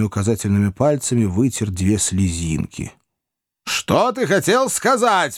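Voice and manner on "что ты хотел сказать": much higher, slow demanding question; Wardle shaking the boy. A narrator's reading. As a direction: shake the answer out of him. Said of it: "встряхнув, спросил его мистер Уордль". (нетерпеливый вопрос)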